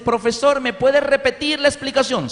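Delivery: students asking. Retold or imitated. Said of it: "Todos los compañeros le dicen".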